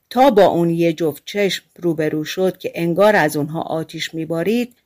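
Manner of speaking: usual speaking voice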